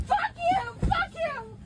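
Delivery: loud, high angry yell; furious shout. Real, not acted. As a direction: spit it at them. Yelling at restrainers.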